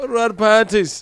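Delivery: put-on high, loud go at an Irish accent; mock Irish accent. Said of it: "Irish accent serious".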